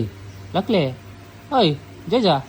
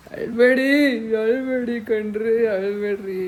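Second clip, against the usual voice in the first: high, slow, drawn-out teasing; mock consoling; 9 semitones higher, slower than usual. Real, not acted. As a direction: stretch it out high to tease her for crying. Teasing crying mother. (ಅಮ್ಮನನ್ನು ಎತ್ತರದ ದನಿಯಲ್ಲಿ ನಿಧಾನವಾಗಿ ರೇಗಿಸುವುದು)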